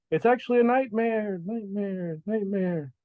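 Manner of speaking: high, falling voice with fading repeats; echoing voice. Acted. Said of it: "it, like, continues to echo".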